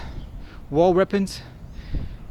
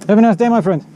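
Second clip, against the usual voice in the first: louder, a cheery goodbye across the shop; loud farewell; 3 semitones higher, 8 dB louder, faster than usual. Real, not acted. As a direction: call the goodbye out to the shopkeeper. Farewell to the shopkeeper.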